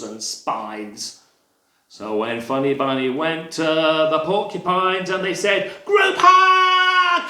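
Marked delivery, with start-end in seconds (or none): 5.8-7.2 high, loud, stretched-out call; gleeful shout. Acted. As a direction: yell it out as an eager invitation. Porcupines call for a hug.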